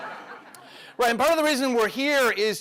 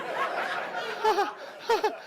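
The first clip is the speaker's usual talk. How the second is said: high falsetto cartoon chuckle; very high mouse laugh; Mickey Mouse giggle; 14 semitones higher, 4 dB quieter than usual. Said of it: "Mickey Mouse here".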